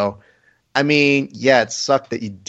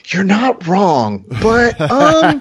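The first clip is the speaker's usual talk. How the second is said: higher, slow put-on voice, brushing it off; mock dismissive; 9 semitones higher, 3 dB louder, much slower than usual. Host waving off a listener's complaint, slow and dismissive. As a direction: drag it out like you couldn't care less.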